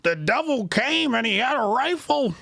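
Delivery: high, hammed-up parody of stump oratory; mock Mufasa speech. Host ridicules senator's lion story, mock Mufasa speech. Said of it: "Fake ass Mufasa speech".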